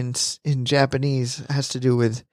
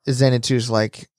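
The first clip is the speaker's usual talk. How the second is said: ordinary talk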